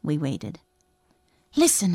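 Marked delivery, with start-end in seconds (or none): none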